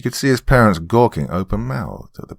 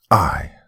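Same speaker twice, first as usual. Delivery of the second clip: "I" set apart and pitched higher; stressed I; 4 semitones higher than usual. A narrator's reading. Mother takes her son's side, stressing "I". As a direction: lean hard on "I", then pause. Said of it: "Helen stressed".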